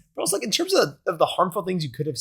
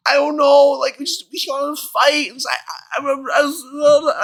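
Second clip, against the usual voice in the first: high, stammering near-tears voice; hammed-up teary excuse, falling apart into stammers; mock tearful; 11 semitones higher, 3 dB louder than usual. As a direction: imitate a flustered kid about to cry. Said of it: "I kinda teared up a little bit".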